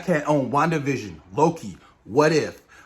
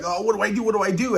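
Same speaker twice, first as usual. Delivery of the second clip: higher, mock-nervous fretting; retold nervous panic; 6 semitones higher, faster than usual. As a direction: imitate a panicky man asking what to do. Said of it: "like, nervous, like".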